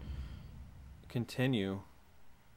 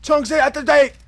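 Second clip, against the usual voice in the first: shouting; 12 semitones higher, 10 dB louder than usual. Pretend. Calling out in a mock marine.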